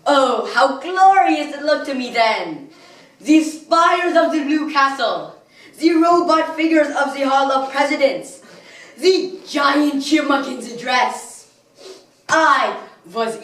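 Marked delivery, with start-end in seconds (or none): 0.1-11.5 high, loud, swelling theatrical awe; rapturous wonder. Acted. Villain recalls his first sight of the Magic Kingdom, rapturous. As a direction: marvel grandly at each sight.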